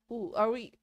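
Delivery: ordinary talk, nothing marked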